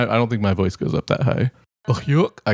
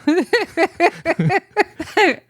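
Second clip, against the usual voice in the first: Goofy laugh. Shown as a demonstration. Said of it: "doing a Goofy impression".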